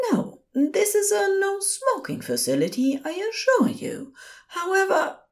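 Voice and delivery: high-pitched, courteous nurse; polite nurse. A narrator's reading. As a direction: reassure the caller politely in a high voice.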